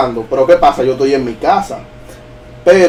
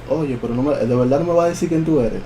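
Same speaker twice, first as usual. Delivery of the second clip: softer retold questioning; talking like this; 6 dB quieter than usual. Dreamer questions the hiding shadow, in a hushed voice. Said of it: "Le empecé a hablar así".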